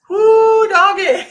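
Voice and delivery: loud, high whoop breaking into a laugh; whooping exclamation. Real, not acted. Psychic marvelling at Farmville's secrets, whooping exclamation. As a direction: holler it with Southern gusto. Exclaims about a wild town.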